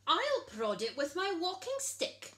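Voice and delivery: lightly voiced old guest; oldest guest. A narrator's reading. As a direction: pipe up like an eager old guest. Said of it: "said the oldest guest".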